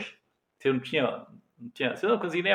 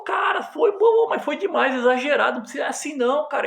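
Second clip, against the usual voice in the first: exasperated shout; 10 semitones higher, 4 dB louder than usual. Retold or imitated. Friend says it was too much.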